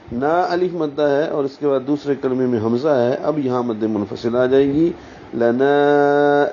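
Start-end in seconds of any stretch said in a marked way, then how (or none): 5.3-6.5 لنا with a very long held final aa; four-count madd. Shown as a demonstration. Teacher showing madd munfasil, لنا hugely drawn out. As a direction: stretch the aa of لنا out slowly and evenly. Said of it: "چار حرکات لمبا کریں گے".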